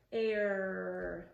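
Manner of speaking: very slow "air", ending on a long R; held final R. Shown as a demonstration. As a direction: stretch "air" and squeeze the R at the end. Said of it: "we're gonna end our R".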